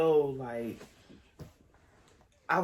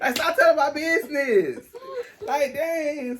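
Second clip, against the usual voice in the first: heated; 11 semitones higher, 8 dB louder than usual. Retold or imitated. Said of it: "I just got heated".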